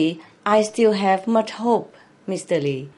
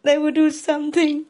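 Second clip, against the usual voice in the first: tearful, higher voice breaking into sobs; overcome with emotion; 4 semitones higher than usual. Real, not acted. Speaker pleads that people will save the children, overcome with emotion. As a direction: choke up and push on through real tears. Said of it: "I'm quite emotional".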